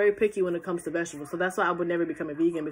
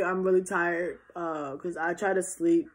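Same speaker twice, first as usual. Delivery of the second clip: ordinary talk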